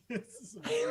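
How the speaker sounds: high, laughing through tears. Real, not acted. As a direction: giggle through it. Laughing at picture.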